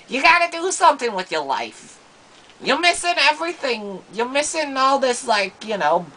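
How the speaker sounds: high, loud mock scolding; mom scolding. Mother scolding her gamer son, loud and high. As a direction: nag him hard like a fed-up mother. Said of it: "she'd be like".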